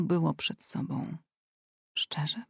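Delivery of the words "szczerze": unvoiced whisper, softer; whispered thought. Anet thinks sarcastically of honesty, whispered. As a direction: mutter it under your breath.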